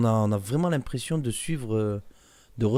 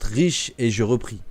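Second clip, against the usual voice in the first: usual speaking voice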